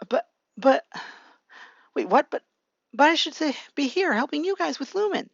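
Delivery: high, stammering protest. Retold or imitated. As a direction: act out startled disbelief with false starts.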